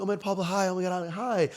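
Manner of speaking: high-pitched mock fan gush; gushing fan greeting. Followers greeting Pablo in the shop, gushing. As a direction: imitate followers thrilled to meet you.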